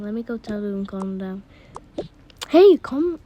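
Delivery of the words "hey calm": raised, higher mock telling-off; pretend yell. Older sibling telling screaming siblings to settle, mock yelling. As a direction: call out sharply to noisy kids. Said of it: "I had to yell at them".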